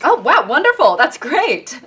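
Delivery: overly polite waiter praise; mock waiter. A waiter in a skit praising tacos, with fake cheer.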